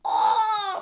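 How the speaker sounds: high loud hyped reaction; crowd ooh. Retold or imitated. Everyone reacting to the insult, loud hyped ooh. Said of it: "everybody was like".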